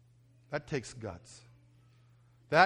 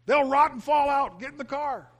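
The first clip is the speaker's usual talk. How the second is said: loud, high exasperated retort; yelling at kids; 12 semitones higher, 9 dB louder than usual. Retold or imitated. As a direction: shout the child's excuse down. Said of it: "So I was yelling at them".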